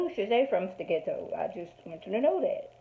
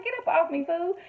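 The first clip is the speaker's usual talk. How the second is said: mock Cookie shove-off; 9 semitones higher than usual. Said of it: "She's like".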